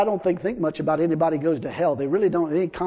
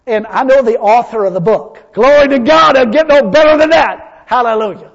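high, very loud shout of praise; exultant pulpit cry at full force; preaching shout; 5 semitones higher, 12 dB louder than usual. Real, not acted. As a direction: shout glory like a revival preacher.

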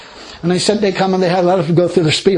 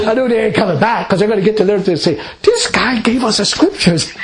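laughing; 3 semitones higher than usual. Real, not acted. Laughing retelling.

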